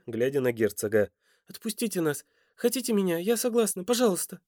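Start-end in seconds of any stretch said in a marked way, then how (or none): 1.5-4.4 high-pitched desperate plea; imploring. A narrator's reading. Woman offers herself to free her family, high and imploring. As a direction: beg urgently, voice lifted high. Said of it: "взмолилась Лера".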